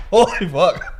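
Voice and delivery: strained gasp through smoke; squeezed out mid-cough; choking on smoke. Real, not acted. Gasping after a big dab hit.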